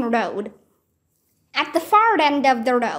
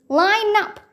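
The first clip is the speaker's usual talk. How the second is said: bellowed order; 8 semitones higher than usual. Acted. Centurion bellowing at the warriors to line up. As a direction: bark the order at the ranks. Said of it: "the centurion bellowed".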